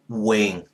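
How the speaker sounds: "wing" said low with a falling tone. Shown as a demonstration. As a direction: let the pitch sink through "wing". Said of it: "That's telling you to lower your voice".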